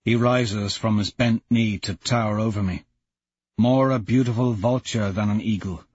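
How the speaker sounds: usual speaking voice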